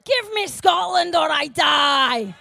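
loud, slow Scottish battle cry, shouted; Scottish accent. Retold or imitated. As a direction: roar it in a hammed-up Scottish accent like a rallying war cry. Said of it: "I was gonna say it in a Scottish accent".

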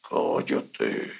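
a low, voiceless rasp, like something not human; horrible voice. Retold or imitated. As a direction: hiss it out as a dark, hoarse whisper. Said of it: "qué voz tan horrible". (voz ronca y horrible, susurrada grave)

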